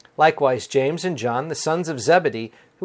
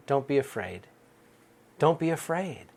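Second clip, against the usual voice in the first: gentle reassurance; 6 dB quieter than usual. Acted. Jesus voiced inviting the disciples.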